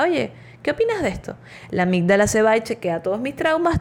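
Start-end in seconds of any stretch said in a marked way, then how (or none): 0.0-1.4 amygdala asking. Retold or imitated. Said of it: "le pregunta".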